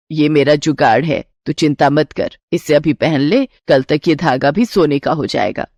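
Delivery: calm her worries in a low mother-in-law voice; reassuring mother-in-law. Acted. Voices the mother-in-law's dialogue.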